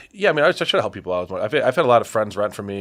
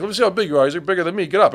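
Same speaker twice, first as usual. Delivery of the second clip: retold challenge; 10 semitones higher, 6 dB louder, faster than usual. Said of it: "he's, like, screaming at me".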